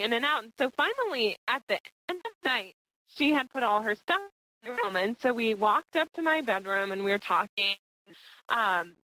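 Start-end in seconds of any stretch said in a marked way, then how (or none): none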